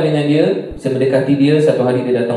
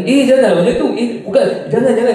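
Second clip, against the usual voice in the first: flustered refusal; 9 semitones higher than usual. Retold or imitated. Refusing the watch gift.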